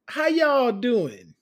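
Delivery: slow, loud Southern greeting; stretched y'all, Southern; Southern drawl. Acted. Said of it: "just oozed naturally out of you".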